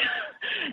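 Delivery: a quick, high burst of disbelieving laughter; short high laugh. Real, not acted. Hearing the therapist's warning, a short high laugh. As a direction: laugh once, stunned by the news.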